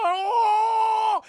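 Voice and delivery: high, loud terrified shout; hammed-up fright yell; scared yell. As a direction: scream in mock terror. Janitor sees monster.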